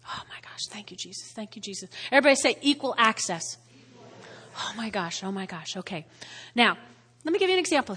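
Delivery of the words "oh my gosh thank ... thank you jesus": whispered, breathy thanks; real overwhelmed murmur; hushed awe. Real, not acted. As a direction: drop to a soft, low prayerful aside. Awed aside after preaching loudly.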